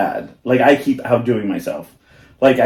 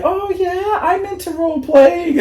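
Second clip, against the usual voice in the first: high, breathy-free gushing; mock eager stranger. Quoting a stranger about role playing, high and eager. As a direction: play the keen stranger up high. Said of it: "they were like".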